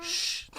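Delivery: soft shush; one low "shh"; hushing. Real, not acted. Hushing a child who interrupts.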